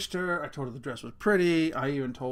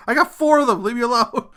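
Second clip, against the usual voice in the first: loud, high-pitched comic outburst; mock defensive shout; 8 semitones higher, 13 dB louder than usual. Dad excusing his bad maths, mock defensive shout. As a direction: yell it in playful self-defence.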